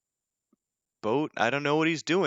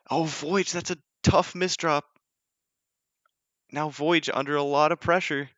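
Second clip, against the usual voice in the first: high, quick esports casting; rising with every misdrop, near shouting at the big moments; excited play-by-play; 8 semitones higher, 5 dB louder than usual. Acted. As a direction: call the action like a hyped caster. Live casting of the match.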